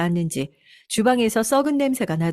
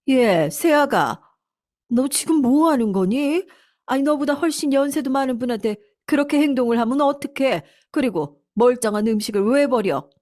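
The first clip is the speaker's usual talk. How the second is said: lightly acted indignant elder; scolding mother-in-law; 6 semitones higher than usual. Mother-in-law confronting daughter-in-law over thrown-out food, mildly indignant high scolding.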